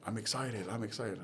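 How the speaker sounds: "I'm excited" muttered softly, just showing the words; low-key run-through. Retold or imitated. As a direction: quietly rehearse "I'm excited" before doing it for real. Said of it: "scream, 'I'm excited,' three times".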